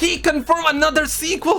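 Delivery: high mock-hype outcry building to a shout; hammed-up shock. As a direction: shriek the news like an overexcited fan. Hammed-up reaction to sequel news.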